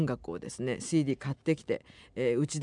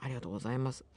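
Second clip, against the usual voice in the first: normal talking voice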